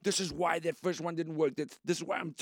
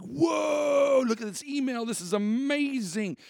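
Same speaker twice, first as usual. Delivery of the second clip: excited outburst; 9 semitones higher, slower than usual. Retold or imitated. Host reading listeners' emails, thrilled and shouting. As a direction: gasp with delight at the inbox. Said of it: "it's like".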